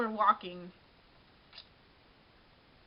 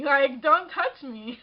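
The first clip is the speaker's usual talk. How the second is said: slow, louder "don't touch me"; firm warning; 5 dB louder, slower than usual. Retold or imitated. Telling the guy off for touching her, firm and slow. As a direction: lay down the law, word by word.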